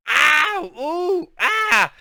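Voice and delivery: high, loud yelps of pain. A narrator's reading. Girl yelping while her hair is pulled, loud. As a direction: yelp as your hair is yanked. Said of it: "Gal was making strange sounds".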